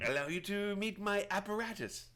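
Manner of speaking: high-pitched hermit showing off; grand introduction in a put-on high voice; Prattis. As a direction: present it proudly as an eccentric hermit. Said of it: "his name's Prattis".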